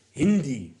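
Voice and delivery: put-on light Middle Eastern accent quoting a film line; mock Middle Eastern accent, slow and wondering; a hint of Middle Eastern accent; imitating Middle Eastern accent. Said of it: "the Middle Eastern guy goes".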